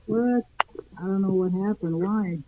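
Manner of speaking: through a wall; muffled and far off, as through a wall; muffled, distant. Real, not acted. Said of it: "You sound muffled and st-, and, and distant".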